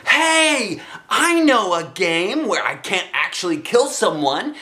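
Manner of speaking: high, excited shout. Acted. Girl shouting a game idea to her mother, high and eager. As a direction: call it out eagerly. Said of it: "I shouted".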